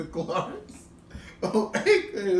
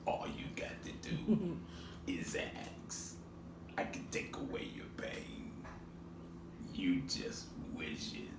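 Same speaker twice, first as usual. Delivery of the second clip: whispered, sinister genie offer; hushed, tempting whisper with long pauses; Wishmaster impression; 7 semitones lower, 13 dB quieter than usual. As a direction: whisper it like a creepy wish-granter. Mimics the Wishmaster offering wishes.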